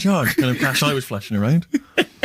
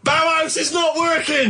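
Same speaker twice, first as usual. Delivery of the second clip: high, slow, drawn-out mock yell; imitated shouting; 13 semitones higher, slower than usual. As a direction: imitate a headphone wearer yelling obliviously. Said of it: "he was just shouting".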